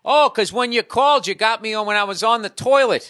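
loud, high-pitched imitation of a phone caller; mock shouting. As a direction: shout it like an oblivious man on speakerphone.

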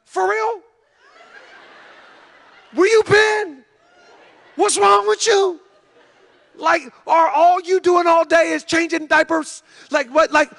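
high, mock-appalled shouting; incredulous outburst. Husband acting out his reaction to his wife, incredulous outburst. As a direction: berate her in exaggerated, high-pitched disbelief.

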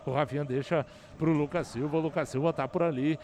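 rapid radio football narration; play-by-play. Real, not acted. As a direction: call the match live, brisk and steady.

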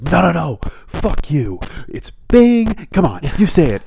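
high, loud, bullying "It's Bing"; mock yell. Boss correcting the developer, shouting "It's Bing". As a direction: boss shouting his staff into line. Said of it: "And he goes".

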